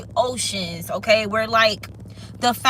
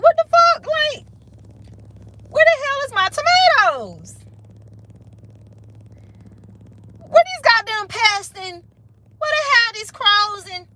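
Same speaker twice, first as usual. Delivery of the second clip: outraged garden owner; 11 semitones higher than usual. Acted. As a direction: play the owner furious at losing her garden.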